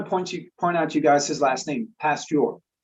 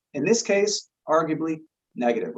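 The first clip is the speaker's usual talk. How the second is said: ordinary talk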